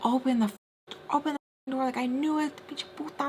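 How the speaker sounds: high, softened mock shouting with cut-off swear; retold furious demand. Friend pounding on the door, high but soft mock shout. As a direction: imitate muffled fury without raising volume.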